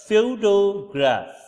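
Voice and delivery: slow, loud photograph, stressed on PHO; first-syllable stress in photograph. Shown as a demonstration. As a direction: draw out photograph from a high PHO. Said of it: "the stress is given at the first syllable".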